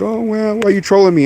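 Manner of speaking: high, whiny imitated complaint; mimicking someone hurt; mock offended. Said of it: "he was already offended".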